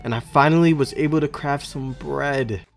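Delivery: loud, energetic recap voiceover; voiceover narration. Acted.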